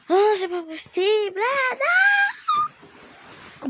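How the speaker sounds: high, loud wail of dismay; dismayed cry. Real, not acted. Gamer finding she's stuck, crying out in loud dismay. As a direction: cry out as the game ruins your plan. Game goes wrong, she despairs.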